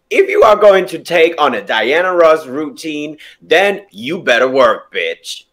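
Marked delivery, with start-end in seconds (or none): none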